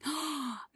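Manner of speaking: real delighted gasp at a gift; sharp breath in of surprise; gasp, amazed by generosity; surprised gasp. Real, not acted. Surprised by gifted memberships.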